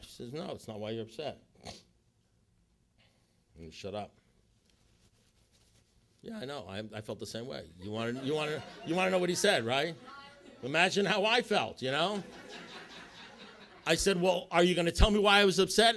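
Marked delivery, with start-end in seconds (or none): 0.3-1.4 soft, unmoved reply; calm contradiction. Retold or imitated. Sponsor calmly turning down his protest, quiet and even. 14.2-16.0 loud, high, impatient question; exasperated demand. Retold or imitated. Retelling how he pressed his sponsor, exasperated and loud.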